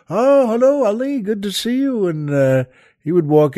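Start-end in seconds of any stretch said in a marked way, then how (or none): 0.1-2.1 eager greeting. Retold or imitated. People greeting Ali eagerly.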